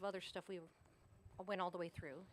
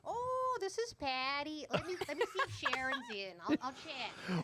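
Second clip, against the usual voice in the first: put-on high, slow northern accent with a laugh through it; mimicking Montana receptionist; 7 semitones higher, 3 dB louder than usual. Wife mimicking her advisor's receptionist: high northern accent, laughing. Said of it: "And she's like".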